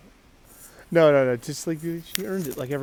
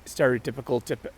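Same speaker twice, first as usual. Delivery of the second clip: ordinary delivery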